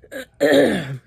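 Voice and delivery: real loud hard throat clearing; clearing pepper powder from the throat. Real, not acted. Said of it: "I'm clearing my throat so much".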